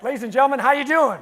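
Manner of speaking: loud, high-pitched showman's call; rallying the crowd. Real, not acted. Speaker asks the audience for their reply, loud rallying call. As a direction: holler it out to pump up the room.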